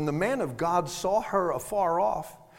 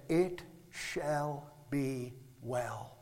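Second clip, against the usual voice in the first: slow, low and soft; measured calm, word by word; hushed, deliberate assurance; composed quiet answer; 4 semitones lower, 9 dB quieter, much slower than usual. Acted. Woman's composed answer to her husband.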